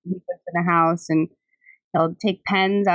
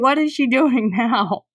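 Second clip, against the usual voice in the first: judging neighbor; 6 semitones higher, 3 dB louder than usual. Retold or imitated. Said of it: "some of my neighbors can't help but, like, shake their head".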